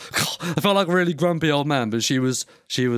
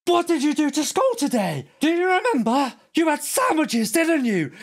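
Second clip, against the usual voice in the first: put-on much higher, carrying mother's voice quizzing her kid; loud sing-song mum; 12 semitones higher than usual. Mocking a loud mum in the chip shop, high sing-song. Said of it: "She was like".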